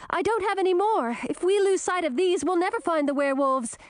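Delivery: high, pressing, urgent call; urgent shouting. A narrator's reading. Girl warning companion while chasing whiskers, high and urgent. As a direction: pitch it up and urge them on. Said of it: "shouted Gracina, sprinting after the whiskers".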